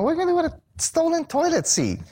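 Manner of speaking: high, exasperated question; puzzled visitor asks the driver, high and incredulous; baffled disbelief. Retold or imitated. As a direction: pitch it way up in total bafflement.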